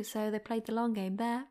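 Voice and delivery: ordinary talk, nothing marked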